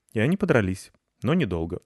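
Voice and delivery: ordinary delivery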